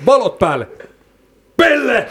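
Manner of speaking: "Valot päälle", long pause, then high "Pelle"; pause before the insult. Retold or imitated. Mimicking friend's shout at the man, pause before "Pelle". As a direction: call "Valot päälle", hold back, then pitch "Pelle" way up. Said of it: "se tauko vielä siinä välissä".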